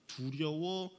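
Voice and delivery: ordinary talk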